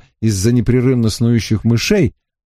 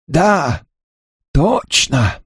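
put-on gummy old man's mumble; toothless mumbling; 3 semitones higher, much slower than usual. A narrator's reading. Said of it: "прошамкал самый старый".

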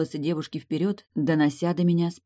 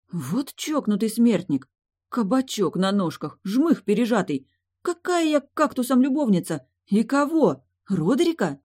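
indignant; 6 semitones higher than usual. A narrator's reading. Heroine fumes inwardly at insult.

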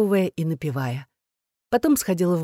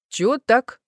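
higher, lightly amused question; wry snicker; 6 semitones higher, slower than usual. A narrator's reading. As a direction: ask with a hint of mockery. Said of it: "хмыкнула подружка". (с лёгкой усмешкой)

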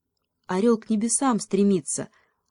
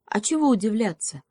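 ordinary delivery

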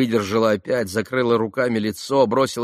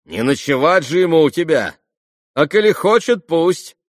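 raised, emphatic sarcasm; loud sardonic retort; caustic teasing; 6 semitones higher, 5 dB louder than usual. A narrator's reading. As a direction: needle them with a biting jibe. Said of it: "язвительно подшутил Ракитин".

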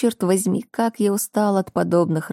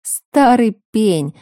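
louder, slow scornful snort; scoffing; 4 semitones higher, 5 dB louder, much slower than usual. Acted. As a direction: spit out the insult with a scoff. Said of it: "фыркает Ник".

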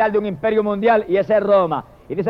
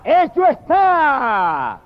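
higher, drawn-out cry from the pulpit; powerful shouted proclamation; 5 semitones higher, much slower than usual. Retold or imitated. Preacher voices the cry from the temple, powerful shout. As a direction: proclaim it slowly like a mighty voice. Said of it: "salió la voz poderosa del templo que gritaba".

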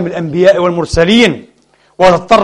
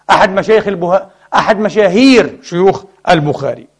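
normal talking voice

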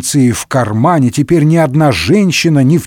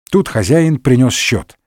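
usual speaking voice

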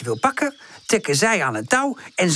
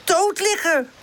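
high-pitched, cheeky boast; high, pleased-with-himself rascal; mischievous; 10 semitones higher than usual. A narrator's reading. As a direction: show off naughtily in a high voice. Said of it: "zegt Charlie ondeugend".

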